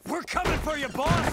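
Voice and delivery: high, rising battle call; rallying cry. Acted. Henchman calling to the kidnapped boss, high rallying cry. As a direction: shout the promise to rescue him.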